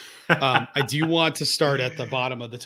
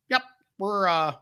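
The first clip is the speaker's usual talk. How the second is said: a higher, half-hearted neighbor impression; imitated sitcom neighbor; 3 semitones higher than usual. Co-host comparing the wrestler to a sitcom neighbor, in a half-hearted impression. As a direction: try the seventies sitcom dad, then give up. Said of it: "sounds like the neighbor on that seventies show".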